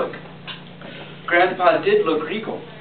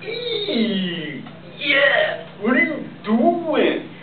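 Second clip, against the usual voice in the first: disgusted Morats; 9 semitones higher, much slower than usual. Retold or imitated. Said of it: "the other Morats say".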